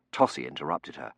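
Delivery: plain reading voice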